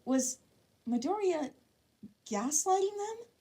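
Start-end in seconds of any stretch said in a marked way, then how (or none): none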